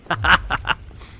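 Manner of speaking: short, high burst of laughter; quick high laugh; real laugh. Real, not acted. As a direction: laugh out at your own blunder. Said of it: "I'm laughing now".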